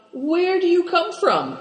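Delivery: high-pitched, casual-sounding query; casual question. A narrator's reading. Captain to the newly arrived stranger, casually asking. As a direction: ask it casually, voice lifted high. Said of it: "asked Dampignac casually".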